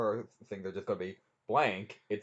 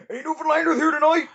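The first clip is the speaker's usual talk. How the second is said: high, very loud crowd call; yelling to a crowd; 12 dB louder than usual. Acted. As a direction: holler it out like hyping a concert audience. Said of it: "you don't wanna yell".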